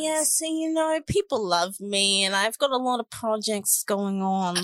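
put-on Jersey accent, Godfather style. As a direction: play a Jersey Godfather type. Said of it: "What, what accent was that?".

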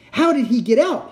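high, loud alarmed cry; cried out. Acted. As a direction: demand it in alarm. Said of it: "he cried".

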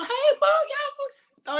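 high, excited call to the stands. Retold or imitated. Player retelling waving to his mama from the bench. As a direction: shout a greeting up to mama.

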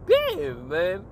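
mock shock at the teacher; stunned exclamation. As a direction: react in disbelief. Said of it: "I'm like".

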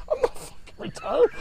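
very high, squeaky mock protest; imitated objection, pitched far up; indignant protest. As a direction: act out an indignant denial in a put-on high voice. Retired man protests in retold scene.

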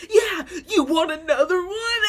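high, loud taunt broken by laughter; laughing mock taunt. Quoting Tessa punching Molly, laughing loud taunt. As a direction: taunt like a brawler while cracking up.

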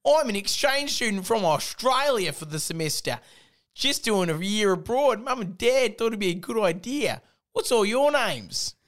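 high, bright-voiced mock Australian exchange student; Australian accent. Reading a silly fan story, [name] introduces himself in an Australian accent. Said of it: "I did an Australian accent".

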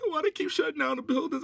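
high, put-on whiny question; mock clueless complainer. Mocking people who went out, now complaining high. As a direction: whine it like the same careless people. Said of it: "Same people now".

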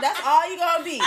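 normal talking voice

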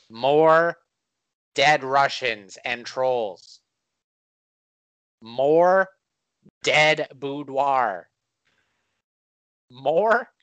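building shout. Acted. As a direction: build the crowd up. Building campaign promises.

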